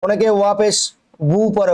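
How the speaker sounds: ordinary talk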